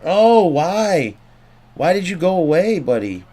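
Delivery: high, pleading complaint; pained complaint. Real, not acted. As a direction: wail it at the player who let you down. Pleading with player in game.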